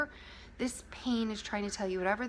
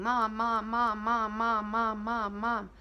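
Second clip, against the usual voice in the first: a hint of a clingy child, louder; crying child calling; 4 dB louder, slower than usual. Little child pulling at mom, repeated calls. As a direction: tug at mom again and again. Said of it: "crying and crying and pulling at you and saying".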